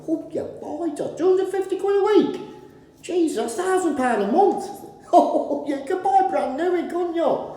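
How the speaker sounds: laughing in shocked disbelief at a rip-off; incredulous. Retold or imitated. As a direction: squeak it out like you can't believe the cost. Retold disbelief at van price.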